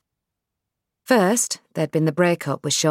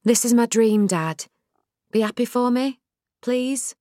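Nell pleading; 7 semitones higher than usual. Acted. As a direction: ask him to share your dream.

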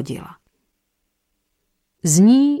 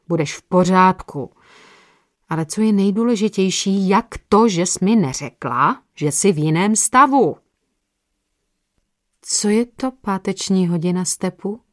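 plain reading voice

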